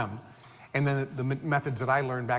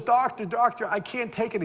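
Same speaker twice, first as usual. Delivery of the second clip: panicked plea; 7 semitones higher than usual. Retold or imitated. Panicked patient cries to doctor.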